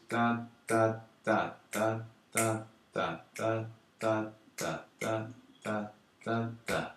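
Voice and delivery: steady run of "da" syllables; rhythm vocalization. Shown as a demonstration. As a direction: tap out a rhythm on "da". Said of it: "It essentially goes".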